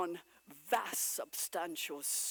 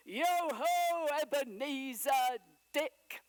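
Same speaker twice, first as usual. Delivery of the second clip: rich, fat, jovial call, much louder; jovial shout; 7 semitones higher, 8 dB louder, slower than usual. A narrator's reading. Fezziwig calls his apprentices, jovial and loud. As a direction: bellow it out like a jolly old boss. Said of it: "shouted out in a rich, oily, fat, jovial voice".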